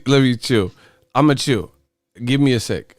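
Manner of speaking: usual speaking voice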